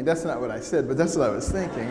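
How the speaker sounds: ordinary talk, nothing marked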